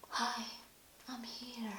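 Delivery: soft whispered little hello, as if the smell speaks; scent saying hello. Pretend. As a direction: whisper it like the perfume greeting you. Voicing the oil's scent talking.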